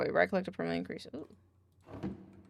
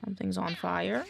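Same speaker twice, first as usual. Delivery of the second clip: normal talking voice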